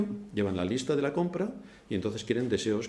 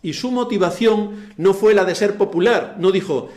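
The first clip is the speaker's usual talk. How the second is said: loud, high impassioned sermon; fervent preaching; 5 semitones higher, 9 dB louder than usual. Acted. Pastor exhorting the congregation, loud and fervent. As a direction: preach it with rising fire.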